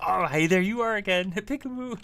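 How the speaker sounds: high, loud, excited puppy greeting; playful dog voice. Pretend. Owner voicing the zoomy dog greeting, high and excited. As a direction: squeal it as the dog finding you again. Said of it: "He's like".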